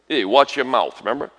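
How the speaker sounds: normal talking voice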